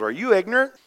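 high-pitched, emphatic challenge; Paul's incredulous rebuke. Acted. Paul confronting the careless church, high and incredulous. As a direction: throw the question back, pitched well up. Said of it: "And Paul goes".

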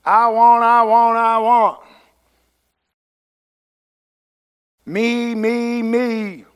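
put-on high, loud whiny child; mock bratty child pleading; spoiled brat whine. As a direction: whine like a greedy spoiled kid. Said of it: "I must sound like a spoiled brat kid".

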